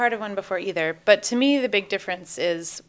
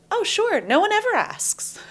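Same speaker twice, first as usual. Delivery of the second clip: lightly voiced breezy official, pitched much higher; mock-cheerful officials; 13 semitones higher than usual. Officials cheerfully agreeing to a first-time request, mock-breezy and high. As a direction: toss it off brightly, like it's no big deal. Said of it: "they were basically like".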